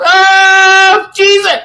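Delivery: huge high shout of joy; ecstatic cry. Retold or imitated. Hyping the club crowd at the mix drop, ecstatic high shout. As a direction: let it rip like the drop just hit.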